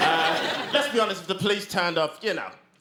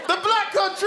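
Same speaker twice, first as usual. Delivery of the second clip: high-pitched, delighted announcement; reply with eager, over-keen enthusiasm; enthusiastic; 10 semitones higher, 3 dB louder than usual. Acted. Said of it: "And I reply enthusiastically".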